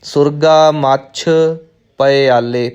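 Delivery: slow recital, ਪਿਆਲੇ opened as "pa-e-aalle"; pause, then give ਪਿਆਲੇ three clear parts; careful split reading. Shown as a demonstration. Teacher modelling for learners, slow ਪਿਆਲੇ as "pa-e-aalle". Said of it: "ਈੜੀ ਨੂੰ ਸਿਹਾਰੀ ਲਾ ਕੇ".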